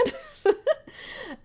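a real high, bright laugh. Real, not acted. Recapping the merman scene to a friend, high laugh.

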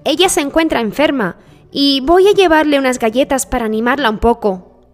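put-on high storybook girl; little girl. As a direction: explain as a sweet little girl. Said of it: "respondió la niña".

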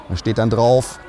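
ordinary talk, nothing marked

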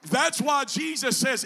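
loud, high, chanted preaching; preaching at full force. Acted. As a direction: whoop it to the congregation. Preacher climaxes again.

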